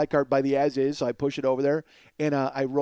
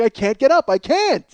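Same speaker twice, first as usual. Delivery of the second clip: higher, louder helpless plea; loud protest; 8 semitones higher, 6 dB louder than usual. Retold or imitated. Rider claiming he can't get up, loud protest. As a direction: insist loudly that you're stuck. Insists he is stuck in chair.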